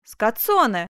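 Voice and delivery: loud cry of the name; exclamation. A narrator's reading. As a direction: call her out sharply. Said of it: "воскликнул Бенвенуто". (громкий возглас)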